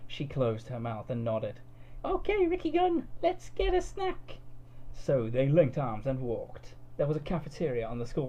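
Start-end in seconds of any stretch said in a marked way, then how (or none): 2.0-4.4 put-on high, slow squeaky girl voice; drawn-out cartoon girl chirp; high, slow cutesy anime girl. A narrator's reading. Cud voiced in story.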